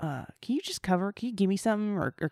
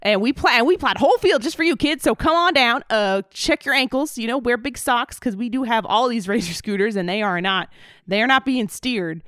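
Huxtable Henry. Acted. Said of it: "it's me, Huxtable Henry".

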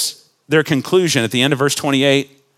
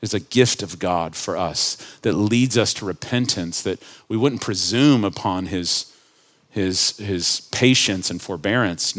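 tender sermon close; 6 semitones lower, 6 dB quieter than usual. Acted. Preacher gently urging the congregation toward repentance, softly. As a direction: ease down and speak gently of God's kindness.

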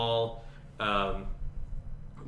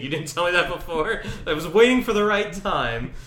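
higher and louder, caught off guard and annoyed; indignant disbelief; 9 semitones higher, 9 dB louder than usual. Retold or imitated. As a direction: act outraged that nobody told her. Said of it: "And she's like".

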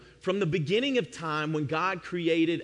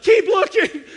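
much higher and louder, yelled across the yard; urgent shout; 13 dB louder, slower than usual. Retold or imitated. Wife shouting from the deck for him to keep searching. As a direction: holler it out to him in the dark.

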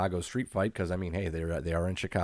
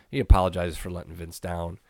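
ordinary talk, nothing marked

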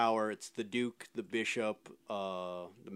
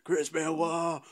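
higher and louder, dragged-out wrestling promo yell; Stone Cold impression; 7 semitones higher, 8 dB louder, much slower than usual. Host imitates Stone Cold cutting a promo, slow hammed-up yell. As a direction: bellow it slowly like a wrestler on the mic. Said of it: "when Stone Cold was like".

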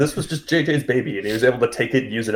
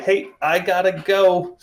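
higher, drawn-out breezy exit line; mock casual; 6 semitones higher than usual. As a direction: play it too cool, slow and higher. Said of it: "is very cool and is like".

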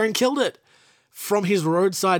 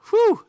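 whew; 5 dB louder than usual. Real, not acted. Reacting to a death at sixty-four.

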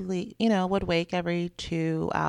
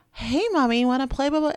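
lightly voiced little kid, pitched higher; toddler's sweet invitation; 6 semitones higher, faster than usual. Toddler asking mommy to play, lightly mimicked child voice. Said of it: "one moment she's like".

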